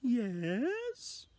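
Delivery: put-on very high old lady voice. Old lady answers the man at her door, very high voice. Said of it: "my best old lady impression".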